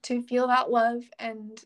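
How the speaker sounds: ordinary delivery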